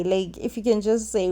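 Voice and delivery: ordinary talk, nothing marked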